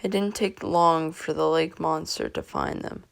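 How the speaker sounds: plain reading voice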